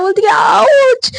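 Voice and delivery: sudden high yelp of pain; Sejal's hurt hand throbs, a sharp high ouch. Acted. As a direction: jolt of a sore hand, cry out high. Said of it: "उसका हाथ बहुत ज्यादा पेन होने लगता है".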